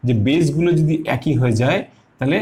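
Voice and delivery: ordinary talk, nothing marked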